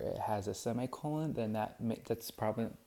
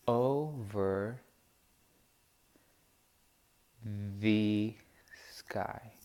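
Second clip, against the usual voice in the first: slow, word by word, stressing each beat; scanning the meter; much slower than usual. Shown as a demonstration. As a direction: tap out the meter in your voice, pausing between words. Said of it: "counting the meters".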